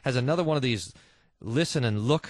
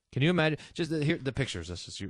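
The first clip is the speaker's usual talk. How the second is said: normal talking voice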